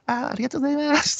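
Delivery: high, fast gushing thanks; hostesses thanking. Retold or imitated. Hostesses thanking the customer, high and fast. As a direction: gush like a club girl.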